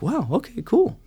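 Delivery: wide-eyed "wow" climbing to a squeak; amazed. Retold or imitated. Reacting to God, surprised.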